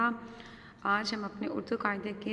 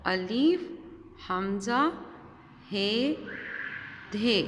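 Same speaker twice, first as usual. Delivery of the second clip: letter drill; much slower than usual. Shown as a demonstration. Teacher calling letters for children to follow, drill-style. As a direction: drill each letter for the class. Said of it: "मेरे साथ reading करें".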